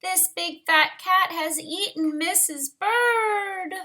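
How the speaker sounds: high, wailing despair; crying. Acted. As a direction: lament it like a bird in grief. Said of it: "he cried".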